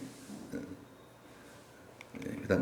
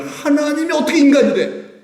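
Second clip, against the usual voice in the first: high, loud mocking disbelief with a laugh; 7 dB louder than usual. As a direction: scoff like a scandalized traditionalist. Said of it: "찌들어져 있기 때문에".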